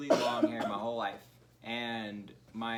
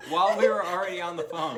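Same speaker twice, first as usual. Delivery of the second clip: loud, fast, laughing through it; laughing loudly at the fake call; 5 semitones higher, 11 dB louder, faster than usual. Real, not acted. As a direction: blurt it out while cracking up.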